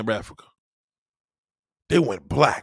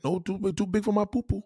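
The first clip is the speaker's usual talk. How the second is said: higher, lighter repeat of the woman's voice; mock woman's protest; 6 semitones higher than usual. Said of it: "They don't like that".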